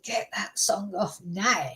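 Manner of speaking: put-on slow Scottish accent slipping into Irish; badly faked Scottish brogue, drawn out; attempted Scottish accent. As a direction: try Scottish and land nearer Irish. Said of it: "That's Irish, isn't it?".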